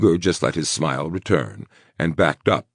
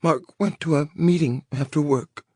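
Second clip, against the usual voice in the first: put-on high, choked small girl voice; choked little girl voice; 12 semitones higher than usual. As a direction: squeeze it out like a scared little girl. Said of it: "in a choked little girl voice said".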